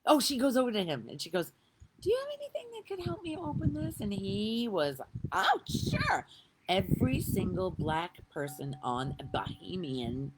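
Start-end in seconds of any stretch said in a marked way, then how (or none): 2.0-4.0 put-on little girl voice. Girl asks the towel man. 5.3-6.3 much higher, bright eager yes; imitating a cheerful eager man; eager helper. Helpful man answering brightly.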